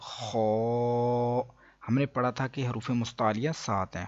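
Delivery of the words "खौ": खौ held long with a full, rounded "aw" vowel; sustained heavy खौ; heavy letter. Shown as a demonstration. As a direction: pronounce खौ thick and full-mouthed, drawn out. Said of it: "इसको हम पुर पढ़ेंगे".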